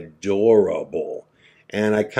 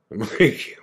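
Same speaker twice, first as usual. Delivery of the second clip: laughing; 8 semitones higher than usual. Real, not acted. Laughing at the French fries.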